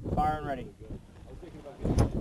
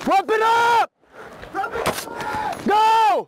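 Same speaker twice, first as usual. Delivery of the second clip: callout shout; 10 semitones higher, 5 dB quieter, slower than usual. Real, not acted. Live fire callouts.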